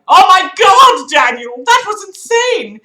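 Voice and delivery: loud excited yelling; excited shout. Acted. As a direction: shout it in amazement. Acted quote of excited kid.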